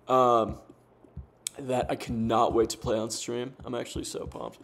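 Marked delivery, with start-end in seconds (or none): 3.6-4.6 whispered aside. Real, not acted. Aside about playlist.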